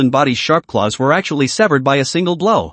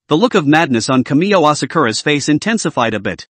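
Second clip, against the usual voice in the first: ordinary delivery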